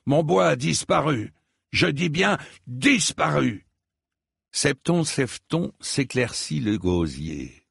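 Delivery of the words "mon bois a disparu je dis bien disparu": put-on higher-pitched indignant old lord; vieux chevalier indigné. A narrator's reading. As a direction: insist on the loss, voice raised in pitch. Acted old knight lamenting his wood. (vieux seigneur indigné)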